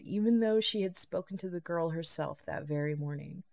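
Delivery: usual speaking voice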